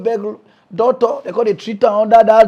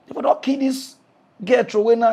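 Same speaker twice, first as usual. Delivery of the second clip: ordinary talk, nothing marked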